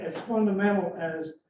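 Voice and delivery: ordinary talk, nothing marked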